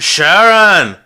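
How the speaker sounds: loud, high call. Retold or imitated. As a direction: yell the name across the room. Calling out in retelling.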